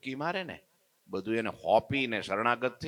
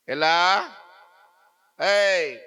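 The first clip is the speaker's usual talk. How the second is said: loud hail; 6 semitones higher, 7 dB louder, much slower than usual. Acted. Old woman hails the shop boy.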